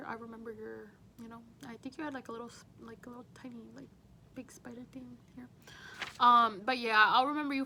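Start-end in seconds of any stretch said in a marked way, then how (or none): 2.3-5.5 quick whispered aside; softer, faster, breathy hush. Real, not acted. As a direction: drop to a whisper for the detail. Describing crazy hair day softly.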